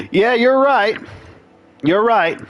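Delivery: high, sing-song mock agreement; sarcastic. Real, not acted. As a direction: concede with an eye-roll. Conceding to chat, mock agreement.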